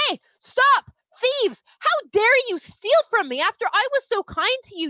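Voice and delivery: loud, high storyteller's mock outrage; outraged accusation. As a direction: call out and accuse them, acting furious. Yosef chases and accuses the brothers.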